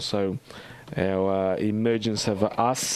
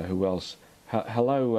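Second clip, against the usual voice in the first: normal talking voice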